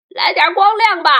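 loud, high cry for light; calling out. A narrator's reading. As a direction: call out loudly. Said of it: "蜈蚣叫道".

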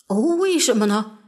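mildly puzzled question. A narrator's reading. Father asks his daughter why, mildly puzzled. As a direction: ask why, a little taken aback. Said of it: "爸爸觉得很奇怪".

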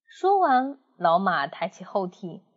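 plain reading voice

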